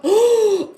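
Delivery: a loud, high sudden gasp; sharp gasp. Real, not acted. Remembering a dance moment, she gasps loudly. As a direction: gasp as the memory hits you. Sudden memory mid-story.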